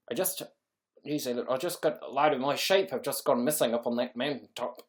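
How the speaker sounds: put-on amateur Kiwi farmer accent; mock New Zealand twang; attempted New Zealand accent. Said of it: "Try a New Zealand accent".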